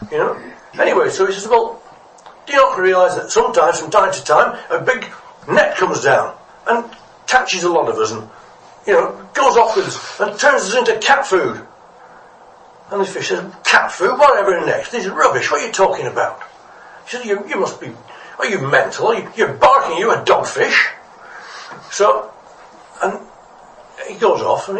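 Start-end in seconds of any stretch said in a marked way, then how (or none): 1.5-11.7 higher, louder pleading explanation; earnest warning. Acted. Little fish warning a scoffing fish of danger, earnest. 13.6-16.4 high, louder incredulous retort; scoffing disbelief. Acted. Scornful fish answering a warning about nets, incredulous. 17.3-20.9 higher, mock-outraged ranting; scornful insults. Other fish abusing the little fish, scornful.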